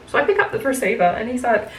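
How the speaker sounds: ordinary talk, nothing marked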